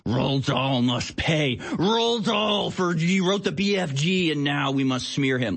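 a lightly voiced fanatic demanding punishment; mock zealot. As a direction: drag it out like a pompous crusader. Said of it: "sitting there going".

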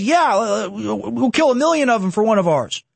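a lightly put-on careless voice; mock lawyer. Mocking the lawyer's words, lightly put-on and careless. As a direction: toss it off like a callous villain. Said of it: "He just says".

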